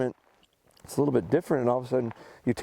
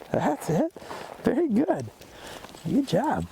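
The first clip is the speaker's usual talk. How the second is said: high, bright dog-praise voice; 13 semitones higher, slower than usual. Real, not acted.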